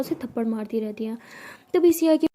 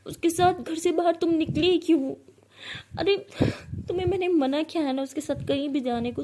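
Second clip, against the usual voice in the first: angry scolding; 6 semitones higher than usual. A narrator's reading. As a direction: scold her for going with Sara. Scolding friend for going out. (गुस्से से डांटते हुए)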